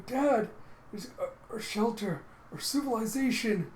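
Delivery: put-on high-pitched boy calling Dad; distressed son. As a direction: cry out in alarm to your father. John calls to his father, alarmed.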